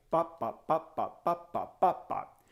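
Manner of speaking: quiet even drum syllables; bop, bop kept soft and even; soft steady bops. Shown as a demonstration. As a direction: tap it out softly. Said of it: "My right hand plays every single".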